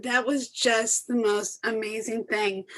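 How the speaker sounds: normal talking voice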